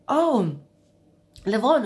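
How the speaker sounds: excited call. Retold or imitated. Stranger rushing over in the queue, calling her name excitedly. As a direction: hail her by name, pleased. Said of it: "and she was like".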